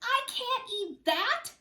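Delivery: high, slow whiny wail; hammed-up child's cry; mock crying. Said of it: "I cried and I cried and I said".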